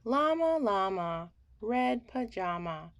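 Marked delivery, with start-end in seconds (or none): none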